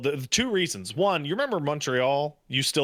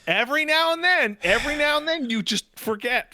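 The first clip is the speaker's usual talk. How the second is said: high-pitched emphasis; 14 semitones higher, 3 dB louder than usual. Real, not acted. Emphatic high repeated phrase.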